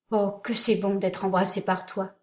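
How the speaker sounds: usual speaking voice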